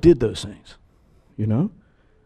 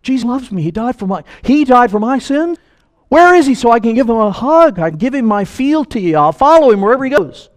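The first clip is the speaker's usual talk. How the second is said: high, loud joyful gush of a new believer; exuberant; 9 semitones higher, 5 dB louder than usual. Retold or imitated. New believer remembering his first love for Jesus, exuberant and high. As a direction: burst out with joy, arms wide. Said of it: "I was exuberant".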